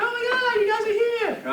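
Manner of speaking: light up like you're thrilled to see them; bright gushing hello to the secretaries; excited greeting. Retold or imitated.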